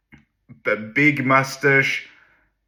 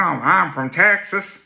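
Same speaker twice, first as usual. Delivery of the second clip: put-on slow, higher drawled Texas country accent; 6 semitones higher, slower than usual. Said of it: "he's got this accent".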